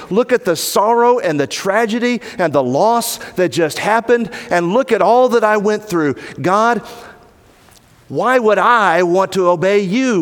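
bitter complaint to God. Acted. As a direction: pour out the grievance, pitch climbing. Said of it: "What should you not say to Jesus".

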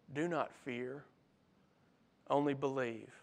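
soft, low, gentle promise; quietly steady a frightened father; tender reassurance. Acted. Jesus comforts grieving father.

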